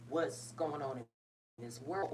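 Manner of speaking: slow down as the feeling catches you; holding back tears; getting emotional. Real, not acted. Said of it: "why am I getting emotional".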